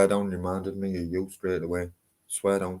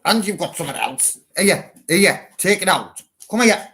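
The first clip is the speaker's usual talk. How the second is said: calling a dog; 9 semitones higher, 8 dB louder than usual. Real, not acted. Dog won't drop it.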